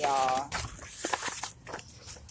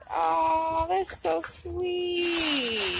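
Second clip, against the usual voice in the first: high, drawn-out tender coo; touched cooing; 8 semitones higher, much slower than usual. Real, not acted. Reacting to farewell cards from clients, touched cooing. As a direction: coo it slowly, stretching the last word.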